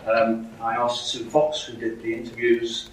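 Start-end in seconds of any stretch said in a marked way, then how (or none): none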